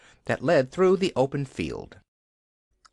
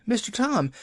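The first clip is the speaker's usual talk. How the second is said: wailing call pitched even higher; second call, more desperate; higher wailing Nancy; 12 semitones higher than usual. A narrator's reading. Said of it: "she wailed".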